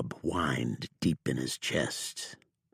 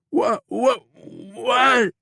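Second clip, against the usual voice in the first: high, loud repeated startled what; alarmed yelps, pitched high and loud; startled outlaw; 8 dB louder, much slower than usual. A narrator's reading. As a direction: jump at the question. Bob alarmed by the question.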